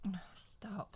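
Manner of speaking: soft, breathy oh stop; creeped-out whisper; horrified whisper. Real, not acted. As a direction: gasp it out under your breath. Horrified reaction to ghost story.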